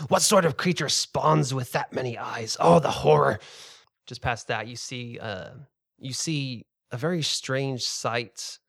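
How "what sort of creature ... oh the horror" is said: loud, hammed-up cry of horror; horrified fisherman. Fisherman recoiling at the many-eyed creature, hammed-up horror. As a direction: wail it like a spooked old sailor.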